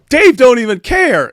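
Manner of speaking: high, loud accusing yell; kid's taunt. Retold or imitated. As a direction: call him out loudly, like a tough kid across the table. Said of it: "he looks at me and he goes".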